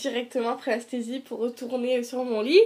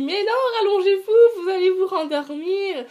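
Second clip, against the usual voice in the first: urgent nurses; 8 semitones higher, 8 dB louder than usual. Retold or imitated. Nurses urging her to lie down.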